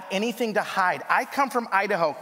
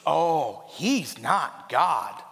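slow flat reply; 3 semitones lower, much slower than usual. Retold or imitated. The sister answers the pastor.

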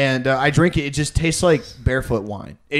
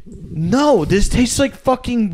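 higher, slow disbelief; incredulous, rising higher; exasperated; 7 semitones higher, much slower than usual. Retold or imitated. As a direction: pitch up in mock exasperation. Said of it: "And I'm like".

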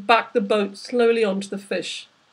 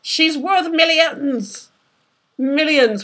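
greedy excitement; 6 semitones higher, 5 dB louder, much slower than usual. A narrator's reading. Greedy captain insists.

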